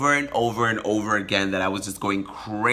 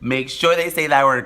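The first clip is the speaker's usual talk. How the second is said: usual speaking voice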